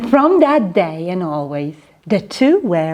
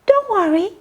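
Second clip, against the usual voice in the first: higher, gentle mouse voice; peeping mouse; 10 semitones higher, faster than usual. Acted. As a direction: reassure in a little mouse voice. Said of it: "Mouse peeped".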